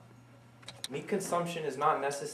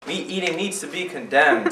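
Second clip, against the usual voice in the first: forceful declaration; 4 semitones higher, 9 dB louder than usual. Acted. Closing line of the prosecution.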